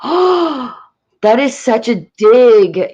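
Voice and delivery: gasping, drawn-out imitated indignation; mock offense. Playing the coworker insulted about baby boomers, mock offended. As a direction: gasp and act scandalized as the coworker. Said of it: "And she was like".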